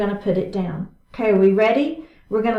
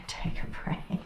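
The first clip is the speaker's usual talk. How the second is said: whispered, breathless release cue; 13 dB quieter than usual. Real, not acted. Instructor to class after the hold, whispered. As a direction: breathe it out like a secret.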